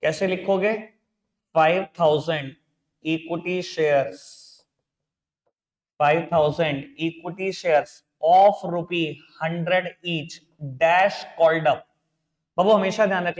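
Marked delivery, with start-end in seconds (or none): 1.5-11.8 very slow, word-by-word delivery; spaced out for copying down; slow dictation. Real, not acted. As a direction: dictate it with drawn-out words and pauses. Teacher dictates the wording to write.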